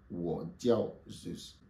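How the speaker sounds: spoken slowly and clearly; slow model phrase. Shown as a demonstration. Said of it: "Répétez après moi". (lentement pour que la classe répète)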